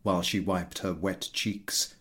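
usual speaking voice

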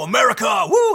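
rowdy bar cheer; mock cheer. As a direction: imitate beer-happy guys whooping. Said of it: "and go".

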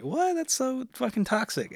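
high-pitched, incredulous retold reaction; shocked friend. Women friends reacting to his girlfriend's rule, shocked. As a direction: imitate friends aghast at what they hear.

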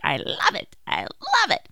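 mock-gushing flight attendant; enthusiastic attendant. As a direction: gush like an eager new attendant. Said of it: "very enthusiastic new flight attendant".

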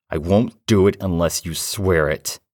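firm demand. Acted. Defiant demand to entity.

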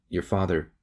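a touch of a breaking voice; words catching, lightly voiced. A narrator's reading. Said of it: "Her voice broke".

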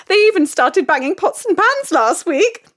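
usual speaking voice

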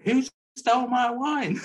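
high, slow, laughing mock indignation; mock drunken outrage. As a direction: ham up a drunk friend accusing everyone. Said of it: "Charlotte kinda kicks off saying".

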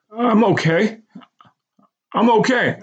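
high, emotional repeated reassurance; stuttering and emotional. A narrator's reading. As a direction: son reassuring mother, voice wobbling. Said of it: "still stuttering and emotional".

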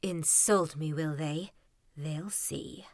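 low bitter mutter fading to a hiss; angry mutter. Acted. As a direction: grumble it under your breath, fading to a whisper. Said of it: "muttered angrily".

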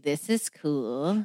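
low, slow and soft; quietly impressed, drawn out; unbothered quote. Retold or imitated. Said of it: "He was actually like".

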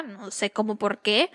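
usual speaking voice

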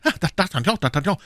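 high, urgent calling of a friend's name; agitated cry. A narrator's reading. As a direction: beg him to stop teasing. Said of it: "rief Aramis".

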